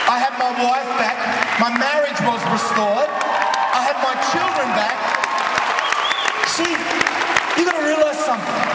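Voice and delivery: high, loud triumphant testimony; full-force preaching. Real, not acted. Preacher telling the crowd his life was restored, triumphant shouting. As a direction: proclaim each line to the crowd, shouting.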